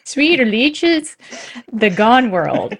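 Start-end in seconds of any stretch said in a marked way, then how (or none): 0.1-1.2 mock Swedish Chef accent on Swederlich's, higher and slow. Shown as a demonstration. Host jokingly says author's name in mock Swedish accent. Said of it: "I need to say that with a Swedish accent".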